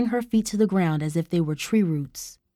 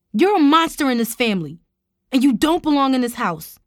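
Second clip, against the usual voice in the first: raised, loud condemnation; roared; 5 semitones higher, 5 dB louder than usual. A narrator's reading. Tara casts uncle out of the family, angry roar. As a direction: denounce them at full anger. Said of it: "Tara roared".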